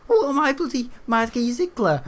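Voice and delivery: high squeaky mock indignation; mock outraged squeal. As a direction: shriek it like a put-upon character.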